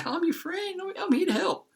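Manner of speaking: very high falsetto fake friend; mock them in a tiny squeaky voice; squeaky mock helper. Mocking poisoners who claim to be his friend, high falsetto.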